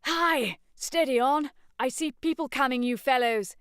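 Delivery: high, loud warning shout; urgent shouted warning; warning call. Acted. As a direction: call out across the woods. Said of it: "his voice changed as if every pine tree was a rural dean".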